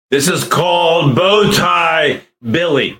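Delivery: slow, high, booming voice changer; loud cartoon voice, drawn out; Bow Tie Billy voice. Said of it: "How does this voice sound?".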